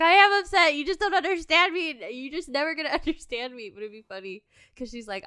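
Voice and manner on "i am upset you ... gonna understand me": loud, much higher mock outburst; imitating upset wife. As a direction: blow up at the husband, hammed-up.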